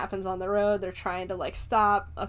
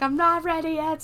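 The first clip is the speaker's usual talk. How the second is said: high, louder hammed-up protest; mock panic; 8 semitones higher, 5 dB louder than usual. Mrs. Deagle not ready to go, mock panic. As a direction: plead in mock alarm, pitched up.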